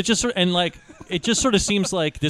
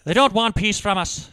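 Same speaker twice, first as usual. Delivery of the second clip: mock Irish accent; 3 semitones higher than usual. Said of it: "Brad Pitt's accent".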